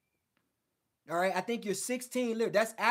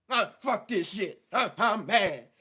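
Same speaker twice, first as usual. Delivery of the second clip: hammed-up frustrated outburst, a bit louder; mock gamer rage; 5 dB louder than usual. Imitating a gamer who loses and rages at the game, mock angry outburst. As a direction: play a sore loser slamming the controller. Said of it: "then you throw the joystick".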